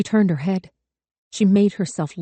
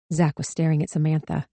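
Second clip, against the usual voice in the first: ordinary delivery, nothing marked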